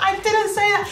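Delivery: high-pitched acted-out protest; re-enacted shouting-match denial; heated protest. Acted screaming quarrel, her reply.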